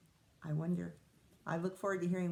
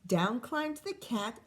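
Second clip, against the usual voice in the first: plain reading voice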